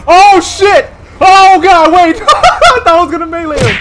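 loud panicked yelling, laughing at the end; startled shout. Real, not acted. Gamer shouting in panic at a sudden attacker. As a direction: yell in real fright as the enemy charges.

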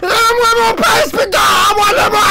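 very high, loud wailing demand; mock crying shout. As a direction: wail for your pass back. Machine takes his pass.

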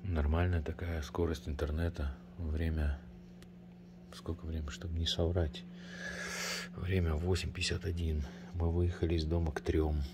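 quiet. Real, not acted. Speaks very quietly.

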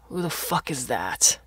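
rough challenge from another room; gruff suspicious father. Acted. Father in bed threatening whoever came home, gruff. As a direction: challenge an intruder, rough-voiced.

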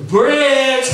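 loud, high, drawn-out call. Retold or imitated. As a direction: call his name insistently. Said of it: "So then a bit later".

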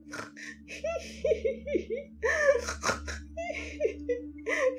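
high, snorting piglike laugh; piggy laugh. A narrator's reading. Sprite laughing like a pig at the narrator. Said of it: "klingt dabei manchmal wie ein Schwein".

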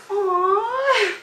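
high, drawn-out swooning aw; gushing fan's coo; adoring aw. Real, not acted. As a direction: sigh an aw, smitten with the idol. Gushing over her idol.

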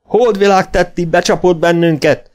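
high, loud furious cry; shrieked accusation. A narrator's reading. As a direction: scream out the betrayal. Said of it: "sövítette Gunnar".

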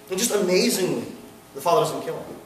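ordinary talk

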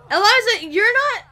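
very high, loud scolding; shrieked mock indignation; shouted reprimand. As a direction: call out the offender in a high yell. Ordering customer from special table.

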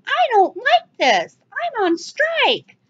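high, slow whiny protester voice; mock the protesters with a high, slow whine. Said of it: "go protest and go".

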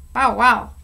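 dog barking happily to Fireman Jim; happy bark. A narrator's reading. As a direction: bark with joy. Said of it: "said Carrie happily".

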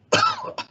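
small high stagey cough; a polite little cough; acted cough. A narrator's reading. Lorax apologising for his cough, small high cough. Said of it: "please pardon my cough".

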